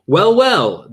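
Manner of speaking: bright cheerful greeting. A narrator's reading. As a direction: say it brightly, pleased. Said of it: "Doctor Milton said brightly".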